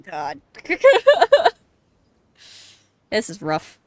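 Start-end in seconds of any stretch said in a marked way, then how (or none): 0.5-1.5 sudden high cackling laugh; stunned nervous laugh; loud burst of laughter. Real, not acted. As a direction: let a disbelieving laugh burst out. Streamer reacts to grim game scene.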